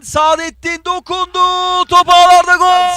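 high, loud, drawn-out goal call; screaming football commentator. Real, not acted. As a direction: stretch the words and scream gol.

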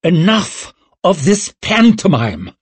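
high, forceful royal snarl; angry snarl. A narrator's reading. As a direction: snarl it to silence the room. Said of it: "snarled Orso".

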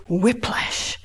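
unvoiced whisper; whispered term for the class. Real, not acted. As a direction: drop to a whisper. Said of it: "whispered term".

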